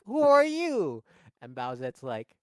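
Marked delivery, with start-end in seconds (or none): 0.1-1.0 startled shout. Retold or imitated. Relatives call out.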